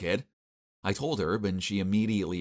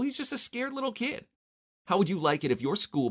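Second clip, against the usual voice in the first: Mila scolding; 12 semitones higher than usual. A narrator's reading.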